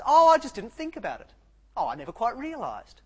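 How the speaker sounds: sing-song fake innocence, over the top; mock excuse. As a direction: play the clueless guy pleading innocence. Men's mock excuse quoted.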